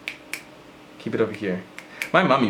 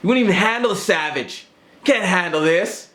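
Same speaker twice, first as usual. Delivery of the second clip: mock savage; 8 semitones higher than usual. Savage boast.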